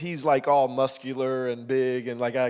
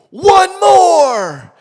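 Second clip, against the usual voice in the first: much higher, louder shout; coach's shout; 17 dB louder than usual. Retold or imitated. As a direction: bark it at him across the gym.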